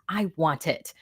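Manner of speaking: hushed, greedy whisper; voiceless eager whisper. Acted. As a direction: breathe it like a secret craving. Eager for bad accents.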